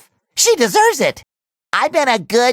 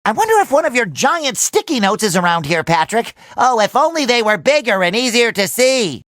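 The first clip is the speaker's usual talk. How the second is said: ordinary talk, nothing marked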